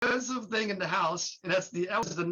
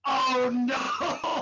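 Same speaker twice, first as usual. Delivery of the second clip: dismayed groan; 6 semitones higher, 5 dB louder than usual. Retold or imitated. Staff dread at the credit card.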